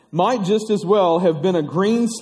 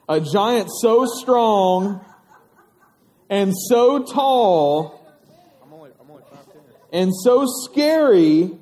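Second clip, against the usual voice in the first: slow, each "so" stretched, building up; slow building drama; slower than usual. A narrator's reading. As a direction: draw it out slowly to build suspense. Building dramatic story description.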